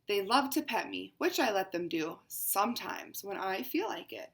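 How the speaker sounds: picture book read as a proud cat; lightly voiced haughty cat; cat narrator, read aloud. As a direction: storytime reading to children.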